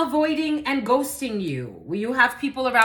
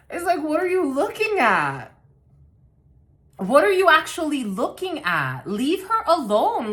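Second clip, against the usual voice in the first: higher, louder, fed-up defence; indignant outburst; 7 semitones higher, 4 dB louder than usual. Real, not acted. Reader scolds the gossipers in a higher, louder indignant voice. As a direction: tell the gossips off.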